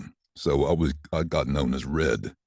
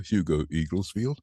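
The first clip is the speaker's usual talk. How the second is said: captain's announcement; 3 semitones higher than usual. Retold or imitated. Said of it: "captain on board said to me".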